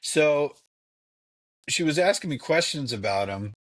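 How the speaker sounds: usual speaking voice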